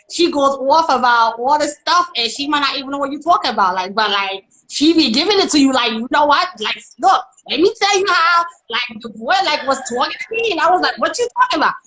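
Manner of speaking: sassy American putting on ratchet talk, pitch leaping up; Taponetta. Pretend. Showing host an American character, ratchet sass. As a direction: give it to them, animated and ratchet. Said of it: "like, ratchet".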